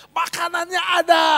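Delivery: high-pitched, carrying yell; shouting. Retold or imitated. Classmate telling his friends about the food, shouting. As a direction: yell the news across to friends. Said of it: "Iya, dia teriak-teriak".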